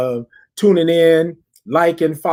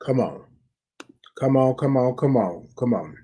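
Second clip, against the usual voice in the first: fading coaxing "come on"; soft urging; 2 semitones lower, 5 dB quieter than usual. Real, not acted. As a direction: coax them to hold the breath, growing quieter. Urging on the breath hold.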